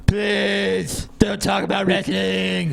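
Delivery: mock a fed-up commenter; whiny annoyed viewer. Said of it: "people are like".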